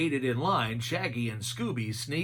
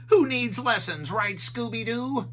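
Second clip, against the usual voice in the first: Shaggy voice; 7 semitones higher than usual. A narrator's reading. Shaggy brags to Scooby.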